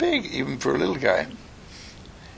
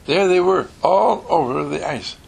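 higher and louder, full of wonder; excited; 8 semitones higher, 4 dB louder than usual. A narrator's reading. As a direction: announce it like a big reveal. Repeated with excitement.

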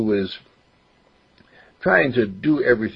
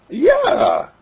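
put-on higher boy's voice, eager; young boy voice. Boy explains he only got in dad's way, higher boyish voice. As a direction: voice a ten-year-old who wants to help. Mark answers.

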